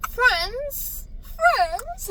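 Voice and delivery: high, incredulous squeal; mock shocked. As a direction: squeal it in fake disbelief.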